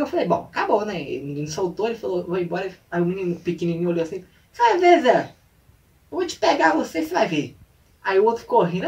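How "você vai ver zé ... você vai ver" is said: small boy's hammed-up threat, high and loud; mock threat. Retold small boy warning the older one, high mock threat. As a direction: threaten a bigger kid, easing off at the end.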